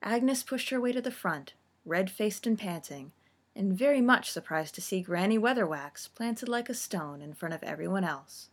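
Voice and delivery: usual speaking voice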